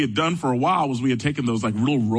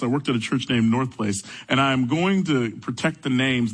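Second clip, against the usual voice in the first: ordinary delivery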